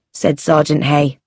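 ordinary delivery

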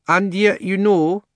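high-pitched, measured lady elephant. A narrator's reading. She-elephant reasoning with the animals, high and deliberate. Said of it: "said the elephant".

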